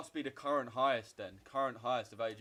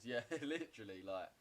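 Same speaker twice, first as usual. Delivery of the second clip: usual speaking voice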